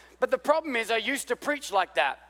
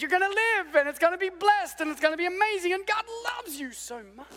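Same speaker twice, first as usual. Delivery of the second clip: mock feel-good pastor; 9 semitones higher than usual. Mocking pastors who only preach blessings, in a syrupy high voice. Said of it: "he says".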